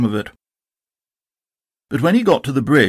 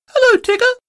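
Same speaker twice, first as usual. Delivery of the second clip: very high and louder; little Roo; 4 dB louder than usual. Acted. Roo greets Tigger, very high. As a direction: squeak a happy hello. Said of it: "said Roo".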